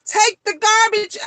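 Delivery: high-pitched mock yell; mock scream. Shown as a demonstration. Mother showing how she yells about chores, mock screaming. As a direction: call it out high, as a pretend scream. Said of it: "if you scream".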